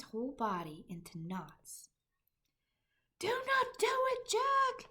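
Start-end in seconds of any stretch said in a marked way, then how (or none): 3.2-4.9 very high, loud frightened screech. A narrator's reading. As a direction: shriek it in terror. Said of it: "he screeched".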